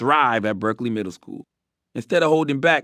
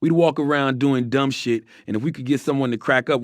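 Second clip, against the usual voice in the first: ordinary delivery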